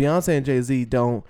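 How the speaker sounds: ordinary delivery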